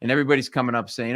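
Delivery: normal talking voice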